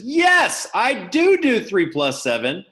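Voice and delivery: loud, high burst of triumph; sudden loud shout. Real, not acted. Teacher startling the online class, loud. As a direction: jolt the class with a loud yes. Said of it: "I scared you with my voice".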